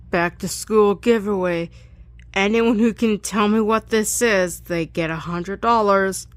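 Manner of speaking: "Back to school giveaway" as a loud, hammed-up announcer; mock game-show host.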